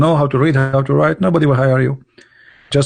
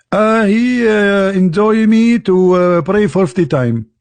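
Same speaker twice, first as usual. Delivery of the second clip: higher, slow, drawn-out reply; halting, raised put-on voice; Muhammad, hesitant answer; 6 semitones higher, slower than usual. Muhammad reporting back to Musa, slow high hesitant voice.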